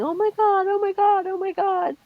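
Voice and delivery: put-on high, loud panicked child's cry; imitated yelling. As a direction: yell it like a girl running upstairs in shock.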